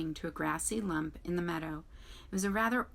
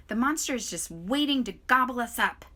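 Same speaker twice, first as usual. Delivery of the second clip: louder, higher panicked shriek; shrieking groundhog; 5 semitones higher, 6 dB louder than usual. A narrator's reading. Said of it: "shrieked Dig Down".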